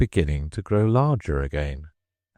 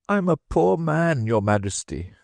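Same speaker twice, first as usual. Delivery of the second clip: high pleading beg; 10 semitones higher than usual. Acted. Hatter kneeling, begging.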